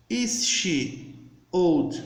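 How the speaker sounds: slow, carefully spaced model question; slow demo English. Shown as a demonstration. Teacher to students learning English, slow and deliberate. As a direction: spell it out slowly for beginners.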